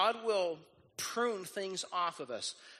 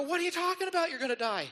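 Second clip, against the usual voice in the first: alarmed questions; 6 semitones higher, 5 dB louder, faster than usual. Retold or imitated. Imagined disciples questioning Jesus.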